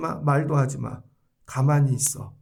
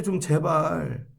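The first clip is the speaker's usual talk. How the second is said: slow exasperated plea; 2 semitones lower, 3 dB quieter, much slower than usual. Real, not acted. Pleading with the congregation.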